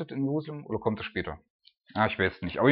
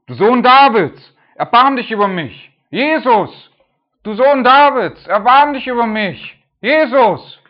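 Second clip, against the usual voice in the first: desperate high shout for mercy; crying out loud; 11 semitones higher, 14 dB louder, slower than usual. Acted. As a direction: cry out to Jesus with all your voice. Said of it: "begann er zu rufen".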